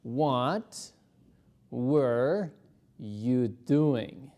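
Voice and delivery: slow, word by word; teacher modelling the question slowly; slow model question. Shown as a demonstration. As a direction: spell it out slowly for learners. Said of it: "detengámonos un ratito ahí".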